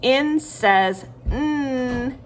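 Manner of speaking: name N, then hold the hummed N; letter then sound. Shown as a demonstration. Teacher leading children to repeat, slow N says N with hummed sound. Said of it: "Say it with me".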